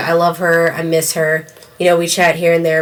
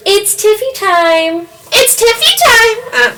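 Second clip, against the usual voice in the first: high, slow singsong catchphrase; Tiffany Stratton catchphrase; slower than usual. Retold or imitated. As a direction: mimic a bubbly wrestler. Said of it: "Thirty minutes of".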